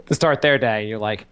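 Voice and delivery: normal talking voice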